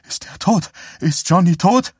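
frantic mother begging to know, voice shooting up high; shrill panicked mother. Acted. Mother panicking over son's accident.

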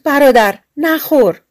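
urgent cry. A narrator's reading. Sister crying out to her brother not to drink, desperate call. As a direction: cry out to stop him before he drinks. Sister cries out too late.

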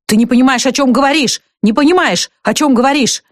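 a husband shaking sense into her; husband scolding his wife while shaking her, angry; angry rebuke. A narrator's reading.

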